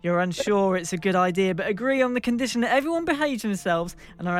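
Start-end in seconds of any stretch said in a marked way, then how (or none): none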